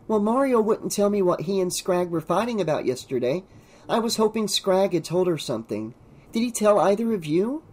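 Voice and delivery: put-on teenage girl character. A narrator's reading. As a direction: lift the pitch, asking friends for news. Narrator voices Carrie in dialogue.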